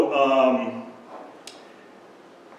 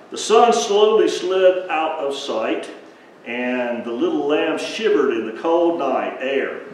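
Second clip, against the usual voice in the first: slow, hushed-in-wonder storytelling; slow dramatic bedtime-story narration; slow storybook narration; 2 semitones higher, 3 dB louder than usual. Acted. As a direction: draw the kids into the night scene.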